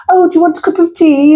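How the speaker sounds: very high, mock genteel British voice. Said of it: "they'd think that Britain was".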